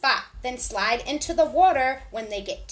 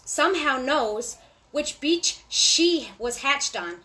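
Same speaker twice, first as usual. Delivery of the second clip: ordinary delivery, nothing marked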